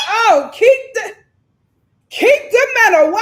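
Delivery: high, loud mock cry; loud high mock plea; mock shout. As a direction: shout it as a pleading joke.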